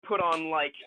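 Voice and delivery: ordinary talk, nothing marked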